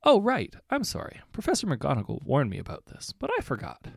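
high, apologetic squeak; flustered young witch. A narrator's reading. Young witch caught out by the professor's warning, flustered and high. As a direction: gasp out the apology in a rush. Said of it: "Babs looked horrified".

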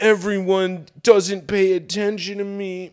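put-on whiny teen. Mocking Holden Caulfield's self-pity, whiny put-on voice. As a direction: whine it like a self-pitying teenager. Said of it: "he's like".

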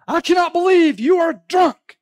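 high, loud mock outrage; indignant Lady Astor. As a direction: shriek it like a scandalised society lady. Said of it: "walked up to him just indignant and said".

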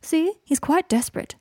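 high, slow, put-on regret; mock sorrowful. A narrator's reading. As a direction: lament it with drawn-out mock sorrow. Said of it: "said Draco sorrowfully".

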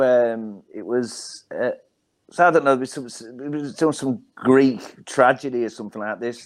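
ordinary talk

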